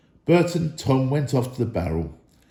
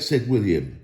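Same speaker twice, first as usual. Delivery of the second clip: usual speaking voice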